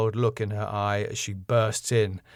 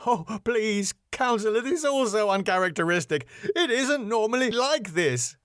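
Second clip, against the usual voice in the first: high-pitched, anxious plea; higher-pitched, flustered appeal; pleading; 11 semitones higher than usual. Acted. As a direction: beg them to believe you. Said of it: "Max pleads".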